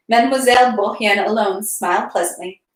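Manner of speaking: usual speaking voice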